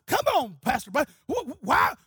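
high, loud mock outrage; angry protest. Fellow preachers berate Brother Buddy over their clothes, angry protest. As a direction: fire off exasperated questions, pitched high. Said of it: "they're mad and angry".

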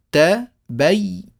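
ت and بي sounded out slowly, letter by letter; spelling out letters. Shown as a demonstration. Teacher spelling out ت، بي one letter at a time. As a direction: count the teeth ت، بي aloud, one by one. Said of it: "هذه ثلاثة أسنان".

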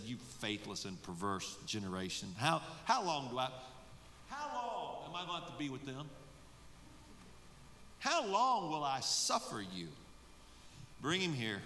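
quiet, weary eye-rolling sigh; frustrated Jesus. Acted. Jesus fed up with his disciples, weary mutter. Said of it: "rolling his eyes, frustrated".

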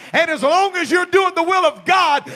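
loud, rising sermon shout; full-force preaching. Acted. Preacher urging his church, loud and building. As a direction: drive it home to the congregation.

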